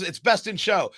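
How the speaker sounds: ordinary talk, nothing marked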